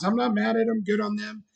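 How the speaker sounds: usual speaking voice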